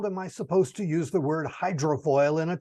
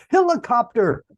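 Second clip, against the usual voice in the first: loud exclamation; 5 semitones higher, 7 dB louder than usual. Real, not acted. Reacting to a thrown word.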